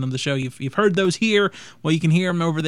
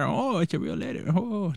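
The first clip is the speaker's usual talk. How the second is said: soft, lightly voiced goofy character; a hint of a dopey comedian; Adam Sandler impression; 2 semitones higher, 6 dB quieter than usual. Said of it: "that was Adam Sandler, not Fozzie".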